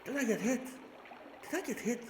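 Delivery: high-pitched squeaky question; anxious squeak. Real, not acted. Player asking whether he was hit, in a high squeak. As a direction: ask it high up, without shouting.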